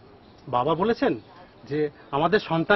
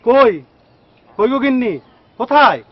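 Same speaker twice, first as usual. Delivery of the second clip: raised, high voice calling his wife from afar; calling out loud; 6 semitones higher, 6 dB louder than usual. Acted. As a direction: call out to find her in the house. (জোরে ডেকে স্ত্রীকে খোঁজা)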